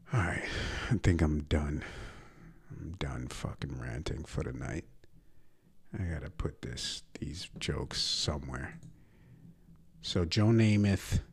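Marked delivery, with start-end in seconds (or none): 2.7-8.8 hushed whisper to himself; whispered aside. Real, not acted. Podcast host winding down his rant, whispered aside. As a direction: drop into a whisper, wrapping up.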